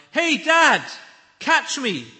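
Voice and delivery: put-on high, loud call of a boy from above; boy's yell. Boy on the cliff yelling to his dad. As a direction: shout it down from the cliff top. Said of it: "I heard a voice from above me yell".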